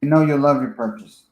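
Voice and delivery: hammed-up corporate politeness; mock formal sign-off. Shown as a demonstration. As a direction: read it like someone you're not. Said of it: "I don't talk like that".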